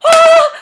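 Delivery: loud, high shriek at a cockroach; a kid yelping at a bug in the book; startled scream. Retold or imitated. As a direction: shriek in fright, laughing.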